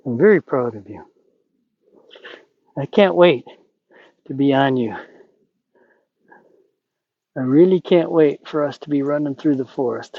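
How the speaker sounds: calm her with gentle words; soft soothing. Real, not acted. Soothing praise to the mare.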